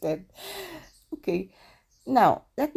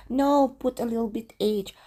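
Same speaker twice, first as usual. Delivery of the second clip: insistent; 6 semitones higher, 4 dB louder than usual. Retold or imitated. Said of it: "So I said".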